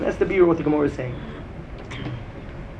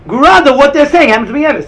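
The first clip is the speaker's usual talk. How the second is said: emphatic teaching; 7 semitones higher, 10 dB louder than usual. Real, not acted. Rebbe stresses the contrast.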